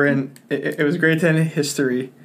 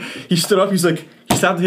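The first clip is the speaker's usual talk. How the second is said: ordinary talk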